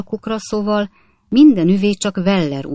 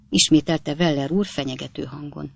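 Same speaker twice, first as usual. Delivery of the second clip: plain reading voice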